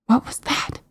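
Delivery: frightened breathy whisper. Acted. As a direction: whisper it in fear. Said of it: "she whispered".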